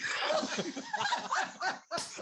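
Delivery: hooting laugh breaking out; big laugh. Real, not acted. Cracking up at own story.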